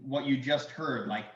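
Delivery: ordinary talk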